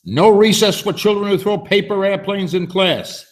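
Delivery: teacher's roar. Acted. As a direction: bellow it like a monster teacher. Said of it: "Miss Kirby roared".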